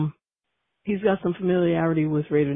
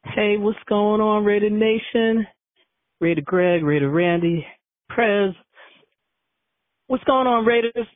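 loud, slow, drawn-out radio shout-out; hyped greeting; 7 semitones higher, 5 dB louder, slower than usual. Real, not acted. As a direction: call out to the whole Nation like a rally. Caller greets the show.

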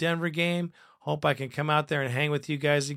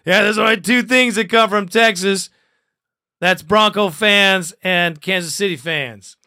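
much louder and higher, building to the joke; loud punchline; 4 semitones higher, 9 dB louder than usual. Shown as a demonstration. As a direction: belt the punchline out. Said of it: "Ha, ha, ha".

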